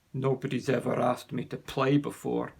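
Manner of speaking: a lightly voiced bully, mumbling; mumbled to himself. A narrator's reading. Bully Billy mumbles to himself, lightly voiced. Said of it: "Billy mumbled to himself".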